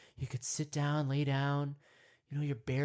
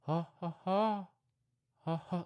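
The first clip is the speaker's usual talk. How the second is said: monkey call; 3 semitones higher than usual. Pretend. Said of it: "like I'm doing right now".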